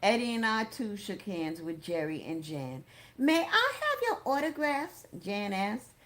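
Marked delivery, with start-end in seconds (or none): 3.2-5.1 put-on high-pitched girlish request; high voice of an excited fan; eager young girl. A narrator's reading. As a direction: ask like a starstruck teenager. Thrilled girl asks for autographs.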